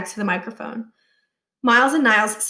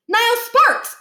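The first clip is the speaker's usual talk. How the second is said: put-on much higher, bright boyish voice; eager kid; 13 semitones higher, 5 dB louder, slower than usual. A narrator's reading. Boy introducing himself to the principal, high and eager. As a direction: chirp the name proudly.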